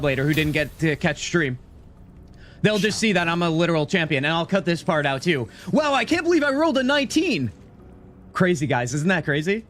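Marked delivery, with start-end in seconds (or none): 5.7-7.5 high, hammed-up astonishment; mock-amazed, pitched well up; feigned amazement. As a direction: put on big surprise for the camera. Fakes a reaction for the edited vod.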